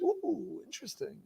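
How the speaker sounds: hushed, mock-intrigued musing; idle observation. Rabbi mocking the idea of a mere observation, hushed. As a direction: murmur it softly, as if noting a curiosity. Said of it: "noting an observation".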